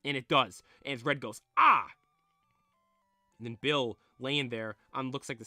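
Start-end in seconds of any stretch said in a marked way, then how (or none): 1.6-1.9 short, high, loud startled yelp; acted sudden yell of alarm; startled cry. Red attacked, cries out.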